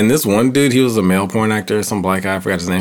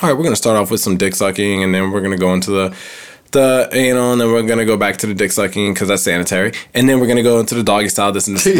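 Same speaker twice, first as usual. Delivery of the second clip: fast director's rundown; faster than usual. Retold or imitated. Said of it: "they got, like, the formula".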